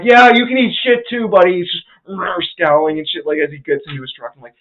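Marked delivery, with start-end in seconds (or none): 0.0-1.5 retold parting jab.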